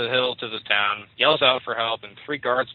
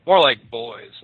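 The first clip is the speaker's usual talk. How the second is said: usual speaking voice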